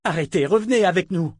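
high, loud plea; urgent cry. A narrator's reading. Woman shaking the entranced wizard, crying out urgently. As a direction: call out to bring him back. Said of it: "cria-t-elle en le secouant".

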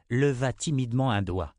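usual speaking voice

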